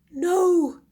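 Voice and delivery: on the edge of tears; nearly crying. Acted. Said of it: "as he nearly started crying".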